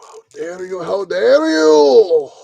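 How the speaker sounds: hammed-up escalating indignation; building mock outrage. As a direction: build from hushed to a drawn-out indignant cry.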